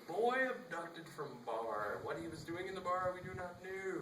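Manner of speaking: news anchor voice. Pretend. Mock Dateline narrator.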